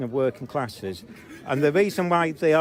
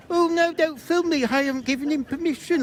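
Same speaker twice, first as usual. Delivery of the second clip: much higher whiny mock plea; mock crying plea; 11 semitones higher than usual. Filmer imitating the woman objecting to filming, high and whiny. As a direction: imitate her as a sniveling child. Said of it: "Am I crying, going".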